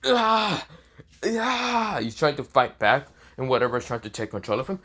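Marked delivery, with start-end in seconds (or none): 0.1-2.0 high, drawn-out yell, not full force; mock scream. A narrator's reading. Issei screams as something takes control of him, mock screaming. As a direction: act out a scream at half volume. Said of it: "this is where he's screaming".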